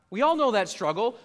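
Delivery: ordinary talk, nothing marked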